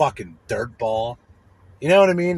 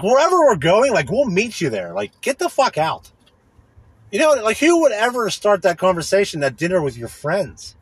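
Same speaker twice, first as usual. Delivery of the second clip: ordinary talk, nothing marked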